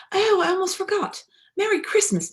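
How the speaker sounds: high eager remembering; bright excited. A narrator's reading. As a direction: pop up with a happy idea. Bear remembers a gift.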